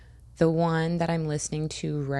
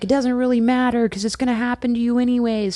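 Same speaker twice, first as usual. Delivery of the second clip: excited; 6 semitones higher, 5 dB louder, faster than usual. Retold or imitated. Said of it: "but I'm like".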